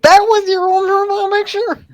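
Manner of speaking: high-pitched astonished question; amazed. Real, not acted. Friend reacting to someone's old profile picture, amazed. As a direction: squeal it in disbelief.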